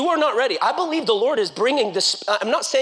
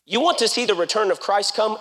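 ordinary delivery

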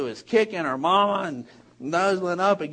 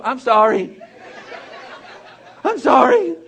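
automatic apology; 4 semitones higher, 11 dB louder than usual. Retold or imitated. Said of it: "I immediately go".

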